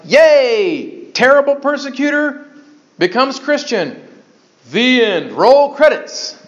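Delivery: high, bright mock cheer for a tidy ending; hammed-up fanfare; mock happy ending. Preacher mocking a fairy-tale ending to his flock, mock cheerful. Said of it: "Is it like".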